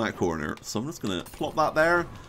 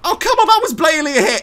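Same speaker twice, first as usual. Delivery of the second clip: fast, high, loud complaint; indignant protest; 13 semitones higher, 8 dB louder, faster than usual. Real, not acted. Player disputing a missed shot, indignant protest. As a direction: protest the unfair call at a rapid shout.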